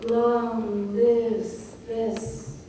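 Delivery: very slow, drawn-out "long lives this"; channelled energy. Shown as a demonstration. Teacher feeling the words as she says them, drawn out. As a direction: stretch each word as if energy moves through it. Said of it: "energy channeled right now when I go".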